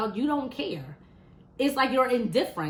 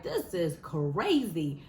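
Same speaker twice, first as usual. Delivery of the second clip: weary disbelief; 7 semitones lower, 3 dB quieter, much slower than usual. Retold or imitated. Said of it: "I'm like".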